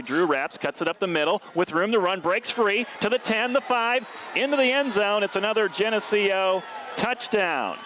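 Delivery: rising play-by-play shout into the end zone; excited touchdown call. Real, not acted. Announcer calling a touchdown, building to a high excited cry.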